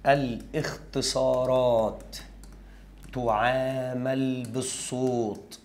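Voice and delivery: very slow, spaced dictation pace; teacher dictating a rule. Shown as a demonstration. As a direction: dictate it slowly so students can write. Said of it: "رقم أربعة".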